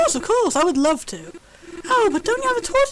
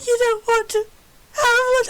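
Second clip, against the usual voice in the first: ordinary talk, nothing marked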